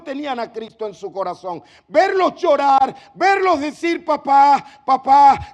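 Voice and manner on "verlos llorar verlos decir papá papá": crying out. Retold or imitated. Youths crying out to fathers.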